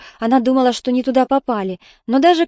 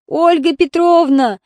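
high, drawn-out wail; 6 semitones higher, 4 dB louder, slower than usual. A narrator's reading. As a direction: wail the name out slowly, high. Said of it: "завыла белугой тётя Рая".

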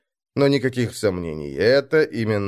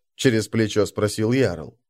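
ordinary delivery

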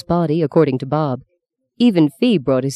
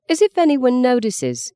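dismissive retort; 9 semitones higher than usual. A narrator's reading. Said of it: "said Meggie".

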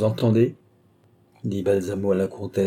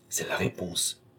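much softer, hushed murmur; a stunned hushed aside; murmured together; 5 semitones lower, 9 dB quieter than usual. A narrator's reading. Duke and countess murmur together, hushed. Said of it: "murmurèrent ensemble le duc de Richelieu et la comtesse".

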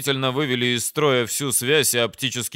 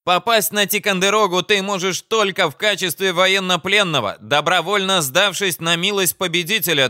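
high-pitched, pompous commander; self-important officer laying down terms; blustering enemy commander; 7 semitones higher, 4 dB louder than usual. Acted. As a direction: declaim it high at a foe.

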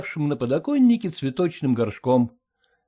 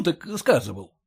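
ordinary delivery, nothing marked

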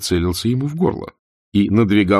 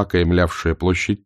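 usual speaking voice

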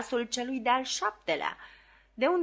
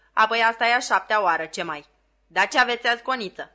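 exasperated scolding; 7 dB louder, faster than usual. A narrator's reading.

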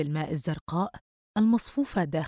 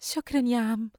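higher-pitched shy thanks; grateful, embarrassed; 6 semitones higher than usual. A narrator's reading. Girl thanking the kind old shopkeeper, grateful and embarrassed. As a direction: thank him with awkward gratitude. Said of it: "وقالت بنبرة ممتنة ومتحرجة".

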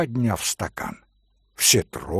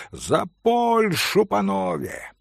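high, slow rousing toast; drunken exclamation to the Polish gentlemen; exclaimed toast; 5 semitones higher, slower than usual. Acted. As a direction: raise the glass and exclaim it. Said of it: "воскликнул Митя".

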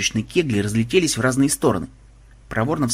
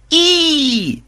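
long, loud, high-pitched squeal; shrill sustained cry; 5 dB louder than usual. A narrator's reading. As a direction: shriek as if flung from a catapult. Squeal as they are launched.

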